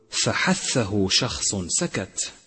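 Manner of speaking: فحثه شخص سكت chanted slowly; recited mnemonic. Shown as a demonstration. Teacher chanting the hams letters for students. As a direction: intone the memory phrase deliberately. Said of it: "وتذكر أن حروف الهمس".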